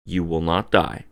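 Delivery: ordinary talk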